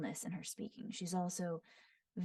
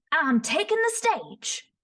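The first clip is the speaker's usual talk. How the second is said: punchy, louder, higher Southern rocker; Southern accent; 10 semitones higher, 8 dB louder than usual. Shown as a demonstration. Voice actor demoing a rock star taking the stage, punchy Southern accent. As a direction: belt it with a Southern twang. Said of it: "Southern accent, very punchy".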